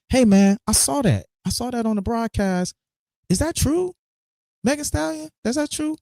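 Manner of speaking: mock caller. Imagining Campbell calling to check the rumor, in a mock high caller voice. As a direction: pitch it way up like a sheepish caller.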